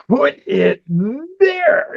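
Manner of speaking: animated cartoon read; lively line read. Shown as a demonstration. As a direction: give it personality and modulation. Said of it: "give it the personality".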